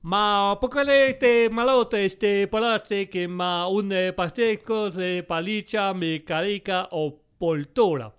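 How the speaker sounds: slow gibberish in a hammed-up Italian accent; sing-song mock Italian, drawn out. Examiner rambles in hammed-up mock Italian. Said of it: "tu fais vachement bien l'accent italien".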